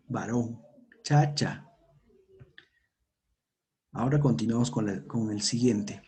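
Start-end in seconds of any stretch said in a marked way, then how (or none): none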